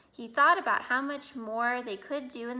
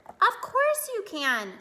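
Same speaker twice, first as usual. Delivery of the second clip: higher, louder, drawn-out encouragement; hearty reassurance; 11 semitones higher, 6 dB louder than usual. A narrator's reading. Father to Arthur who fears forgetting, hearty reassurance. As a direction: cheer him on confidently.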